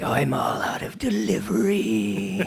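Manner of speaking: slow high raspy whisper; whispered dragon voice. Acted. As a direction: hiss it like a snake. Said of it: "and be like".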